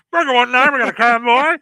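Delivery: loud trucker radio call. Pretend. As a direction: call it out over the CB. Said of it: "Cheryl's got a C B".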